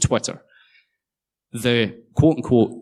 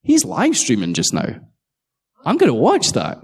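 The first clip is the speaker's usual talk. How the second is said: higher pitched sudden excitement; mock excited; 7 semitones higher than usual. Spotting the live stream, excited. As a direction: jump on the discovery, eager. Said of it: "we sit there and go".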